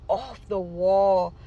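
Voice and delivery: slow, deliberate repeat; very slow emphasis. Real, not acted. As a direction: drag each word out. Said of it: "I'm just saying off the wall stuff".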